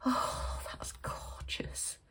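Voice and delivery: slow, very soft whisper; breathless hushed admiration; awed whisper. Real, not acted. Admiring the owl gift, whispered awe.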